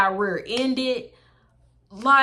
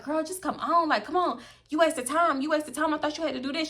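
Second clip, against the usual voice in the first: friend's pleading; 6 semitones higher, 3 dB quieter than usual. Retold or imitated. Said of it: "She was like".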